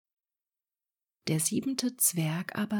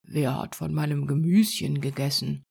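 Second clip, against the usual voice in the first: lower, louder gruff little dwarf; fourth dwarf; 4 semitones lower, 5 dB louder than usual. Acted. Fourth dwarf complaining about his vegetables, lower and louder. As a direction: drop down and push it out, grumbling. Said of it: "Der vierte".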